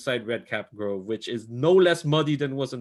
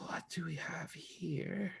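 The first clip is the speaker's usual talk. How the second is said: hoarse, hushed and menacing; 3 semitones lower, 13 dB quieter than usual. Acted. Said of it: "a rather hoarse voice".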